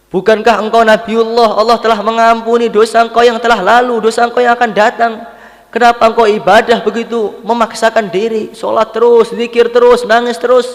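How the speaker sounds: high, loud, insistent questioning; urgent pleading protest. Retold or imitated. Wife questions her weeping husband's endless worship, urgent protest.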